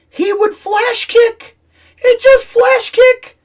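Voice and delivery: very high, drawn-out incredulous complaint; exasperated disbelief. Real, not acted. Gamer recalling Guile's sudden flash kick, exasperated disbelief. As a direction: stretch it out in squeaky-high disbelief. Exasperated retelling of unfair move.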